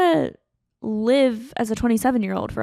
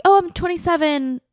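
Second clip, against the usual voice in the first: high, louder, bright-voiced reply of a breezy self; lightly acted carefree self, higher and louder; mock chirpy answer; 8 semitones higher, 6 dB louder than usual. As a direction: toss it off brightly, pitched well up. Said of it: "I'm like".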